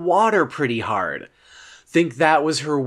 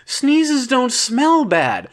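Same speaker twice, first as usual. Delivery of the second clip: high, slow, drawn-out protest; indignant defence; 9 semitones higher, much slower than usual. Acted. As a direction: protest it slowly, pitched way up. Indignant defence of sneezing in closet.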